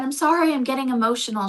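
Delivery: mild retold choked-up apology; retold emotion. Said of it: "She was a little emotional".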